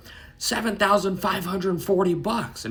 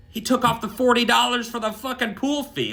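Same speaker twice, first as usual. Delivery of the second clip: higher-pitched incredulous complaint; mock indignation, pitched up; indignant protest; 7 semitones higher than usual. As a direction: retell it as an aggrieved protest. Acted indignant protest over bill.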